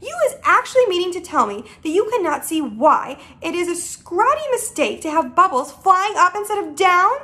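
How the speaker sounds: mildly indignant lecture; a touch of exasperated astonishment; incredulous cry. Acted. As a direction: build disbelief toward the final question. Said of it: "cried the B F G".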